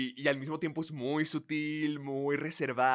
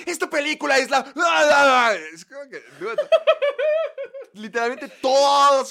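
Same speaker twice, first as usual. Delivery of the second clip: high, loud hyped yell; screaming for views; exaggerated shouting; 11 semitones higher, 13 dB louder than usual. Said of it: "empiezan así a gritarlo todo exageradamente".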